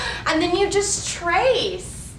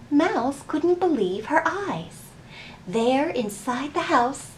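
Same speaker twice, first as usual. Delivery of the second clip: put-on gentle storybook reading voice for children; story narration; 3 semitones lower, 5 dB quieter, slower than usual. A narrator's reading. Teacher reading a picture book aloud to the children, gentle storytelling.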